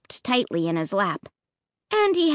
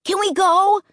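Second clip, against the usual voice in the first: put-on high, pushy kid's demand; 10 semitones higher, 4 dB louder than usual. A narrator's reading. Boy ignores Dad's order, demanding. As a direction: demand it, pitch way up. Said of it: "Luke demanded".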